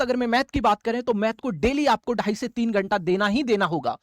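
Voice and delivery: ordinary talk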